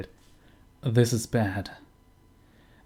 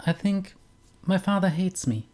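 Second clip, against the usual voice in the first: voiced much higher as the daughter, hurt and small; young woman's voice; 7 semitones higher than usual. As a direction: lift into a young woman's register, wounded. Girl's line voiced in game.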